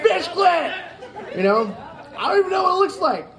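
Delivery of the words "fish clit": high yelp of the tic. Retold or imitated. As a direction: blurt it out sharply high. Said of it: "I was just like".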